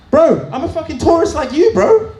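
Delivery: high, loud exasperated protest; indignant disbelief. Retold or imitated. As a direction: squeal it in disbelief. Indignant reply to tourists.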